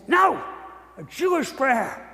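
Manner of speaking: sharp high "No!" then calmer; mock teacher scolding; teacher yelling. Teacher scolds the boy for wrong prayer, sharp yell. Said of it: "she started screaming at me".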